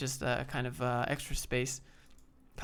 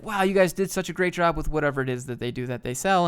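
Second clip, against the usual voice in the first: louder, bubbly praise; gushing customer; 6 dB louder than usual. Retold or imitated. Pretend customer gushes to a small company, bubbly and louder. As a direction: lightly gush like a thrilled customer. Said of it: "pretend you're a customer".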